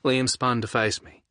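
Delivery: plain reading voice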